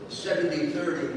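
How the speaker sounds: ordinary talk, nothing marked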